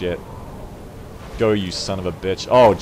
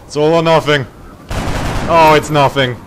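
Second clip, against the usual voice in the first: louder, higher proclamation; dramatic loud; 4 semitones higher, 7 dB louder than usual. Real, not acted. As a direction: announce the gamble grandly. Dramatic gamble announcement.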